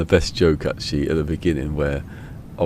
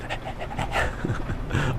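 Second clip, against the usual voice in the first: rapid breathy pants; pretend dog panting; 10 semitones higher, 5 dB quieter than usual. Man pretending to be the dog on the phone, panting. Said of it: "has to pretend to be the dog".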